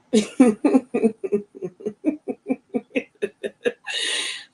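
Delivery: a long, high, real burst of laughter. Real, not acted. Laughing at a viewer's joke in chat, real laughter.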